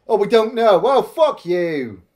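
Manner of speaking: ordinary talk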